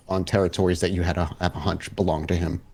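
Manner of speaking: ordinary talk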